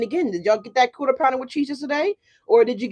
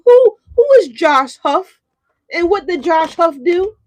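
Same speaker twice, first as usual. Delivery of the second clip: mock incredulous; 6 semitones higher, 7 dB louder than usual. Real, not acted. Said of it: "hammed-up curiosity".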